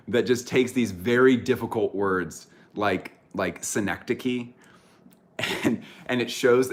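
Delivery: usual speaking voice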